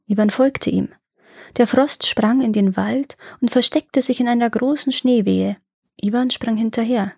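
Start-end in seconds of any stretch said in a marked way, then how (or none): none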